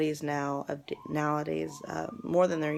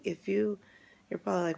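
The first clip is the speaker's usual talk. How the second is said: normal talking voice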